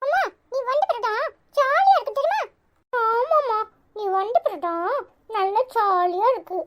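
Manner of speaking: put-on high, childish chatter to mom; little child voice. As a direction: play a small kid bragging. Child voices talking to mother.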